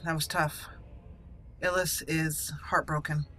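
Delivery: normal talking voice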